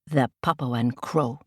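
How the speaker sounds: plain reading voice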